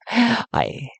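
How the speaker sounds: normal talking voice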